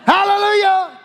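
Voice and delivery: high, full-voiced praise shout; ringing hallelujah cries, held out. Shown as a demonstration. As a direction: shout praise to God with everything you have. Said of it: "What's the Hebrew word for shout?".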